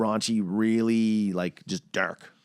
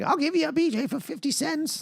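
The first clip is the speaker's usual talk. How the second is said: high, squeaky creepy puppet voice. Acted. Junkie puppet propositioning for change, high creepy voice. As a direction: offer it like a seedy puppet. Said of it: "Weird, creepy fucking puppets selling drugs".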